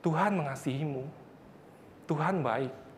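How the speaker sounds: very soft, unhurried pastor's reply; quietly reassure, voicing the pastor; gentle reassurance. Pastor gently answers the weeping man. (lembut menenangkan, suara pelan)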